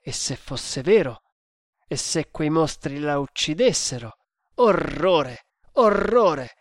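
dramatic, distraught storybook hero; horrified hero. Acted. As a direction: exclaim in horror, then implore your god. Hero horrified, then prays.

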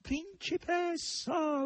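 high, long-drawn whimpering wail; high whiny wail; whining yell. A narrator's reading. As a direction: wail it like a pitiful pig. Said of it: "urlò il verro con voce piagnucolosa".

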